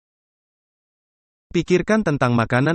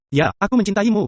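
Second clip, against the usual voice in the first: higher, affectionate brush-off; husband soothing his wife on the phone; mock loving reply; 7 semitones higher than usual. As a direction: toss off an I love you. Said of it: "Sayang, kau sudah makan?".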